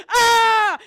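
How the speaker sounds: loud, high cathartic scream; full scream. Acted. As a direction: let it all out in one scream. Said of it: "And so does he".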